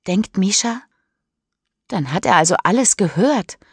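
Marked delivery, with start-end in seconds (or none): none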